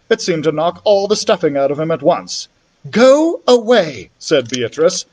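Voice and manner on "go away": stern command. Acted. Beatrice drives off the boy.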